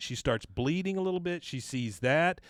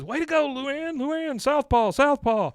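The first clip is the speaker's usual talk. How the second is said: much higher, loud rooting shout; ringside cheer; 12 semitones higher, 7 dB louder, slower than usual. Retold or imitated. Hank cheering Luann's punch, high, loud shout.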